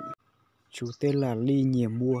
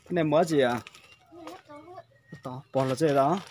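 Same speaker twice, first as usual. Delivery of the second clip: to someone hard of hearing; 2 semitones higher, 4 dB louder, faster than usual. Real, not acted. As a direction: talk up so she can hear you. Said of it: "phải nói to to bà cụ mới nghe được".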